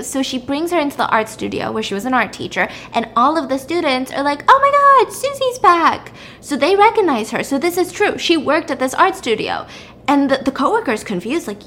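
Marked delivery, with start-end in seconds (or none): 4.5-6.1 high, loud excited cry; excited squeal. Retold or imitated. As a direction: squeal her name happily. Students recognize her.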